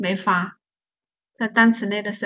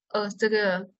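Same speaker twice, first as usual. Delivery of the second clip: normal talking voice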